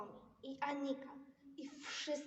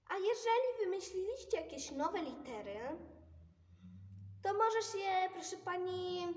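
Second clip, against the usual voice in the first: Pippi voice; 4 semitones higher, 6 dB louder than usual. Acted. Pipi voiced, loud.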